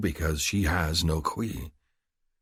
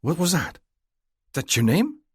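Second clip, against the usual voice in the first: much higher, startled woman's question; 11 semitones higher, 5 dB louder than usual. A narrator's reading. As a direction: voice her jolted and amazed. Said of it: "startled, and looks up".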